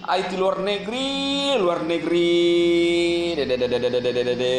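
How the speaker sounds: slow, low, sneering drone; mock singsong of nonsense; mocking drawl. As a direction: drag it out to ridicule him. Mocking the critic's claims.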